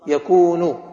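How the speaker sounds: يكون said loud with a long, held "oo"; drawn-out waw. Shown as a demonstration. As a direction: lean hard on the waw in يكون. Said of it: "الواو حركتها السكون". (مدّ الواو في «يكون» بصوت قوي)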